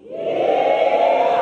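high, sung-out cowboy holler; big yeehaw. Shown as a demonstration. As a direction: throw your hat up and yeehaw. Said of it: "you're gonna give a big yeehaw".

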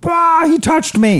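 long, very high swoon; mock fan squeal. Acts out a starstruck fan.

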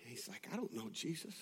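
soft, low, solemn sermon voice; hushed preaching. Real, not acted. Preacher reflecting on Peter's failure, hushed and solemn. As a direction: drop to a grave hush with long pauses.

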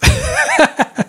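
sudden high squeal of a laugh; real high-pitched laugh at a comment; high burst of laughter. Real, not acted. Reacting to comment about cats.

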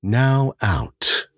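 slow, calm counting of breaths; guided breathing. Acted. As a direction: lead the listener through breathing, gently. Guided breathing before the tale.

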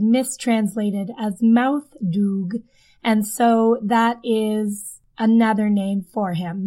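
ordinary talk, nothing marked

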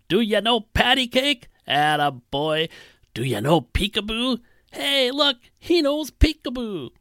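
put-on high, excited calls to a baby; drunk old man shouting baby talk. Old bum shouting across the room to the baby, high and excited. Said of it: "the old bum was shouting across the room".

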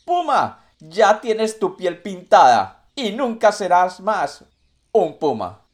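taunting shout from afar. Acted. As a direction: yell it out mockingly while running off. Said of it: "le gritó".

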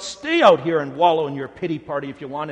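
loud angry retort; mock furious parent yell; pretend shout. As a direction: snap it at the sulking child. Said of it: "might you shout".

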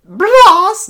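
very high "Brass" with the r trilled on the lips; high-pitched rolled r. Shown as a demonstration. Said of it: "I tried to do a high pitch like Ian".

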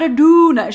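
donut said as "DOO-nuh"; crazy pronunciation. Retold or imitated. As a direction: say donut with a long oo and no final t, loudly. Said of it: "She's also saying it crazy".

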